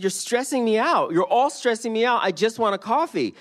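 loud, high mock exasperation; hammed-up stressed customer, loud and high. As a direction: act out being flustered at the waiters. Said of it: "I said".

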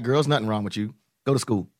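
low, softly voiced mother brushing it off; imitated dismissive mom. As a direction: send the kid off in a low mom voice. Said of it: "Then she was like".

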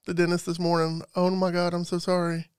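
normal talking voice

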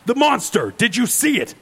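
put-on a stranger presses the girls urgently; urgent question. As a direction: demand an answer, urgent. Man urgently asks the girls.

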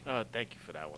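hushed aside off mic; whispering. Real, not acted. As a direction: whisper it under your breath. Whispered aside between hosts.

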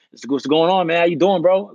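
casual New York street greeting; New York accent. Retold or imitated. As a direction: greet them like a New Yorker. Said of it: "I'm straight in the New York accent".

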